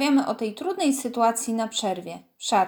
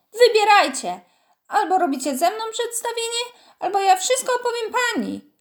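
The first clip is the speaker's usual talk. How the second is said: much higher, much louder bellowing ultimatum; terrible roar; 10 semitones higher, 5 dB louder than usual. A narrator's reading. Kostek roaring an ultimatum at the group, furious. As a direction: roar the choice at them like a little tyrant. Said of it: "ryknął strasznym głosem".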